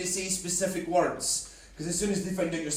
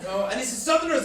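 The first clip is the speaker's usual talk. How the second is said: normal talking voice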